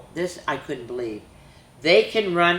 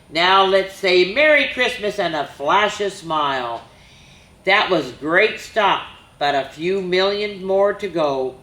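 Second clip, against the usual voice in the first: put-on bouncy rhyming read-aloud for kids; lively story reading; 3 semitones higher, 5 dB louder than usual. A narrator's reading. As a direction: read it like a picture book to children. Reads a rhyming reindeer story.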